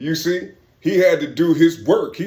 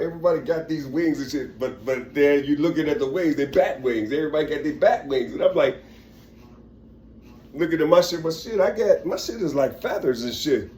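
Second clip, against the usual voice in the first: ordinary delivery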